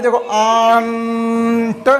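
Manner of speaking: आंट stretched very slowly, sound by sound; drawn-out phonics demo. Shown as a demonstration. Teacher to class, slowly sounding out आंट. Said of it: "जो हिंदी में आवाज़ आ रही".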